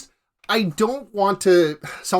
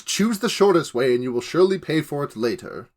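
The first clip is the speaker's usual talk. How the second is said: ordinary talk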